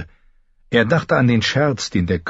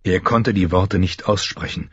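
ordinary delivery

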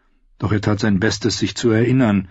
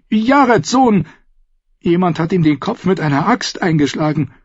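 high, heated self-justification; agitated captive, shrill; 13 semitones higher, 4 dB louder than usual. Acted. Captive recounts his losses to the outlaws, agitated. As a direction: pour out the grievances in a high voice.

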